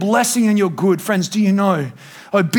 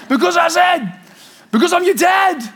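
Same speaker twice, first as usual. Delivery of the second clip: stern dad; 7 semitones higher than usual. Retold or imitated. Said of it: "How many parents have said that".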